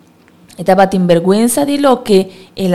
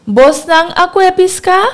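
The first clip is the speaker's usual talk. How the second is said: high shouted call across the water; high hail from the beach; 11 semitones higher than usual. A narrator's reading. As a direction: call out from the shore to the boat. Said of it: "El hombre a grita".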